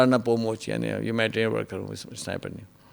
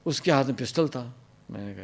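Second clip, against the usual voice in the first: ordinary talk, nothing marked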